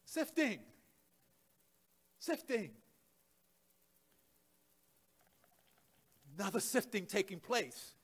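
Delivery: very soft, spaced-out words; hushed pronouncement. Real, not acted. Preacher telling the church another sifting is happening, hushed. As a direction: drop right down and let it hang. Preacher drops to solemn aside.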